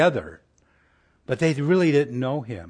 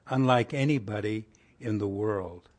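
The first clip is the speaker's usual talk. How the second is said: ordinary talk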